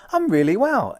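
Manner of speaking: high, loud, upbeat answer; positive reply. Acted. Customer answering the person behind the till, upbeat and loud. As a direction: answer emphatically on the positive side. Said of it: "It's not a negative, it's a positive".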